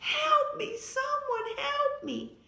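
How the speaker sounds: high, loud cry of "Help! Help!"; screaming for help. Acted. Girl alone in the woods screams for help. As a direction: scream for rescue from down a cliff. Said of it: "She screamed over and over again".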